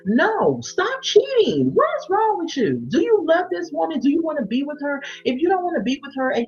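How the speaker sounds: high-pitched, incredulous telling-off; exasperated scolding. Acted. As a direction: confront the cheater, pitch way up. Scolds the cheating husband directly.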